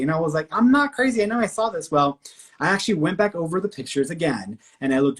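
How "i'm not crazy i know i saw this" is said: high, loud, exasperated denial; annoyed insistence. Retold or imitated. Arguing with sister and her partner, annoyed and loud. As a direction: insist you're not crazy, fed up. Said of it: "I was so annoyed".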